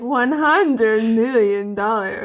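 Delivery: a loose hint of a smug villain; Doctor Evil. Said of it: "Austin Powers, Doctor Evil".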